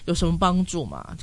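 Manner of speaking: ordinary delivery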